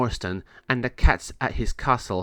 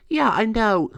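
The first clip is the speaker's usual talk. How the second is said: cat voice; 11 semitones higher, 4 dB louder than usual. Acted. Dory answers.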